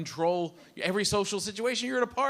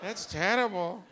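polite housekeeper; 2 semitones higher, much slower than usual. Retold or imitated. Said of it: "This poor woman's like".

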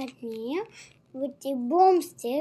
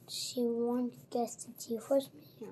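whispering; 2 semitones lower, 8 dB quieter than usual. A narrator's reading. Fearful hope, whispered.

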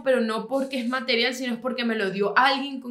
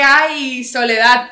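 mock lament; 2 semitones higher, 9 dB louder, much slower than usual. Mocking her own loneliness.

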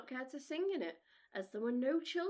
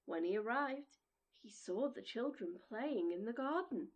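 ordinary delivery, nothing marked

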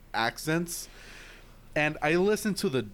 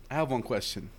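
usual speaking voice

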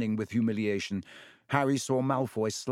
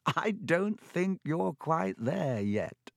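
chortling; 7 semitones higher, much slower than usual. A narrator's reading. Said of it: "he gave a little chortle".

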